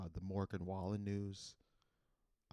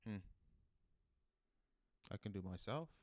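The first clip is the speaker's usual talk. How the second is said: ordinary delivery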